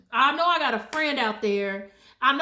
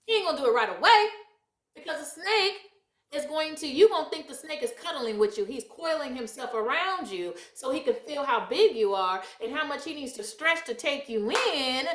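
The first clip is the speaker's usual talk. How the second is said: ordinary talk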